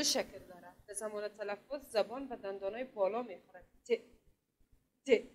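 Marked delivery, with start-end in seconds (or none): 3.8-5.2 crisp isolated t sound; ت said alone and crisp, tongue on the upper teeth; model t sound. Shown as a demonstration. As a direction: model the letter sound for children to repeat. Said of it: "تکرار کنین".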